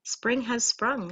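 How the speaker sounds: usual speaking voice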